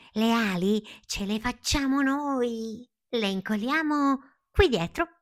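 high, excited little voice; eager caterpillar. A narrator's reading. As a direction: announce the plan brightly and high. Palmira announces a new plan.

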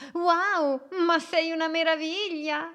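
little boat marvelling at the octopus, high and loud; amazed exclamation. Acted. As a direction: gasp at the octopus in awe. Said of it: "Lei esclamò".

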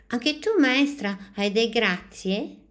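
put-on a small child eagerly asking the teacher; curious child. A narrator's reading. Ughetto asks the teacher for her thanks, high and eager.

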